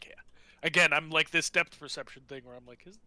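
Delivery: ordinary talk, nothing marked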